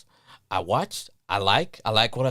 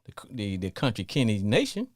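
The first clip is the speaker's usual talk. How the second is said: ordinary talk, nothing marked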